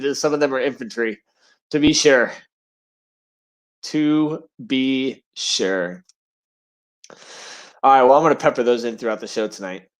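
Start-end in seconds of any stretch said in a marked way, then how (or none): 3.8-6.0 very slow, word by word; drawn out. Shown as a demonstration. Host underlining his infantry joke, deliberately drawn out. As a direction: stretch out each word with pauses. Repeats phrase slowly for emphasis.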